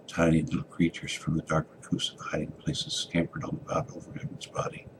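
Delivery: plain reading voice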